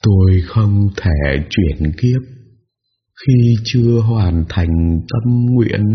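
very slow, mournful ghostly voice; drawn-out grieving old ghost; ghost old woman. A narrator's reading.

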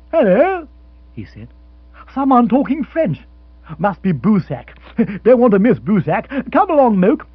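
high, loud, eager bustle; eager Rory. Acted. Rory rushing off to hear the French boxer, eagerly. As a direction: hurry off eagerly, chuckling.

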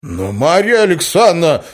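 high, loud protest; exasperated cry. A narrator's reading. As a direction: cry out at her indignantly. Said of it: "вскричал он". (возмущённый возглас)

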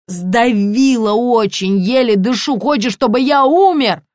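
loud, strained plea for rescue; laboured complaint, rising in pitch and force; squeezed breathless. A narrator's reading. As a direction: squeeze the words out as if the belt crushes you. Еле дышу.

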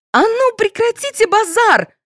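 very high-pitched angry order; exasperated, high scolding; losing her temper. A narrator's reading. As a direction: snap at them to stop, voice shot up. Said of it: "вышла из себя Анюта".

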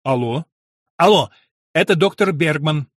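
loud, urgent bursts into the phone; impatient caller demanding attention; quick, impatient. A narrator's reading. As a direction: fire off hellos, then announce yourself. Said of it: "быстро и нетерпеливо заговорил иностранец".

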